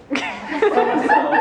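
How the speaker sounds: slow, high, laughing through the words; amused drawl. Acted. Dumbledore chuckling to the camera about his fame, slow and high. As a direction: savour it with a self-satisfied chuckle.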